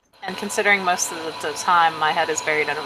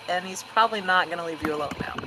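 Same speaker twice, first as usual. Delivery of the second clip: ordinary talk, nothing marked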